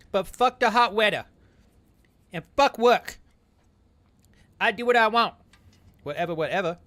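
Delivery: put-on high, loud childish tough-guy voice; half baby, half grown man; baby flex voice. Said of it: "half baby flex voice and half very obviously grown man voice".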